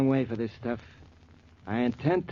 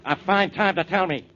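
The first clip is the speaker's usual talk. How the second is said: annoyed outburst; 6 semitones higher, 7 dB louder than usual. Acted. Inspector splashed with mud, complaining.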